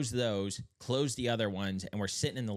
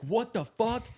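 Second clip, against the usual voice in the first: high alarmed what the fuck; shrieking in sudden shock; high panicked cry at a rat in the bed; startled yell; 11 semitones higher, 4 dB louder, slower than usual. Retold or imitated. Waking to a possum, alarmed.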